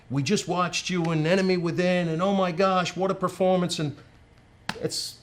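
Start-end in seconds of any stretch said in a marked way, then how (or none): 0.1-3.8 high, excited fan gushing; gushing fan quote. Retold or imitated. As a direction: gush like a starstruck fan.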